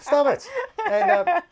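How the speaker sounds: high, loud laughing "stop it"; laughing protest. Real, not acted. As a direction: laugh while you push back at the teasing. Reacting to co-host's teasing.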